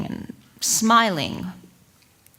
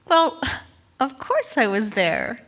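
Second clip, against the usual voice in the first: jumpy, higher-pitched self-justification; defensive protest; 5 semitones higher than usual. Acted. Wife caught out about her judo class, defensive. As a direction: protest it, then hurry into an excuse.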